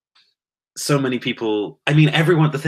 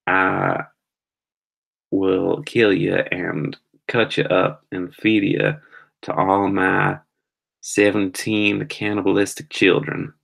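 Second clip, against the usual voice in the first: put-on terrible Southern accent; slower than usual. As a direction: drawl it like a badly faked Southern US villain. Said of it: "I was doing a terrible Southern".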